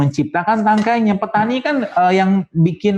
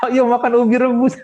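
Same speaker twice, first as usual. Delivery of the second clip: mock coaxing parent; 8 semitones higher than usual. Parent coaxing a child to eat.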